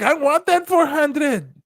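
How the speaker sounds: high, slow, wanting it bad; lightly mocked greedy girl. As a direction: whine for the money. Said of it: "She's like".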